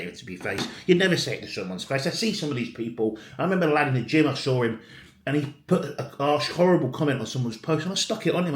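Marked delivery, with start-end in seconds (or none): none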